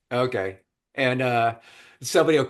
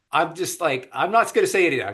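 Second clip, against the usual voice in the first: ordinary delivery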